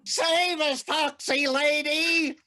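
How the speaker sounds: high, drawn-out comic wail for help; high-pitched hammy cry for rescue; panicked plea. Acted. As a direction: plead desperately in cartoon terror. Frightened character clings to leg, pleading.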